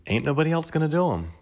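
sassy punchline; emphatic. Real, not acted. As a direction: throw it out with attitude. Emphatic punchline about self-reliance.

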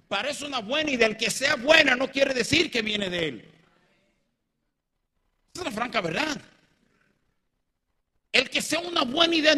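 high, loud pulpit delivery; forceful preaching. Real, not acted. Preacher warns congregation about good ideas, forceful preaching. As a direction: drive the warning home from the pulpit.

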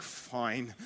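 low, quiet grudging assent; begrudging. Acted. Peter giving in to the rabbi's request, begrudgingly. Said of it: "I imagine begrudgingly".

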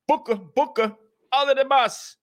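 high put-on hype voice; mock shoutout. Mocking the rival's shoutouts in a high voice.

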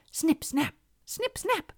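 high, crisp little snips; snipping claws. A narrator's reading. Land crabs cutting a hole, high snipping. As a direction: chant it in tiny crab-like bursts.

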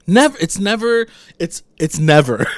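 NEVER rising high and loud, repeated; emphatic never. Real, not acted. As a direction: hammer the word "Never" harder each time. Emphatic exasperated denial.

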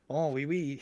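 mock French accent. Co-host recalls their French bits, mock French accent. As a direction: toss off a quiet, hammy French accent. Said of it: "they must have liked our French accents".

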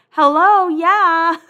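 high, loud mock indignant hello; loud sarcastic agreement with her brother; exaggerated hello. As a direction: hit it big and sarcastic. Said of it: "I'm like".

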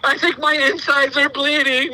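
put-on high, slow kid whimper; mock dramatic child. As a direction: exaggerate like a child overplaying an injury. Dad continues voicing the dramatic child.

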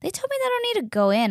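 rushing to tell her sister; puzzled, high and rapid; flustered. Retold or imitated. Telling sister.

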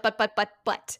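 ordinary talk

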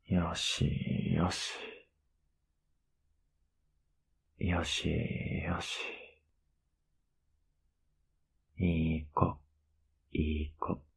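hushed, soothing head-pat murmur; soft whisper. Acted. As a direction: barely breathe the words to lull her. 頭を撫でながら寝かしつける. (囁き声で優しく)

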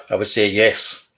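ordinary delivery

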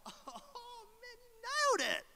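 high, overjoyed exclamation; a sarcastic burst of fake delight; mock delighted. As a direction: gush as if she nailed it. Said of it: "and I'm like".

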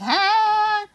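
very high drawn-out squeal; playful squeal. Acted. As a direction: let out a long, high party-girl squeal. Playful squeal about hot girl birthday.

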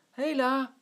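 loud high hail upward; calling up. Acted. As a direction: call up to the gutter, loud. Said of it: "roept Zwartje naar boven".

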